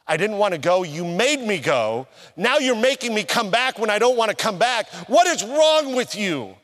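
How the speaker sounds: heated protest to God, pitch climbing high; angry complaint. Retold or imitated. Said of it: "I was angry".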